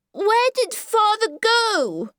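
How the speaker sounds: put-on a small boy calling out across the saloon; piercing high child's cry. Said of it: "asked Howard in piercing tones".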